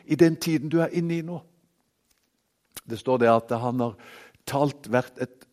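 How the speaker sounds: usual speaking voice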